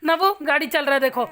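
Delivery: high, loud urgent coaxing. Real, not acted. Mother calls loudly and high over her baby's crying.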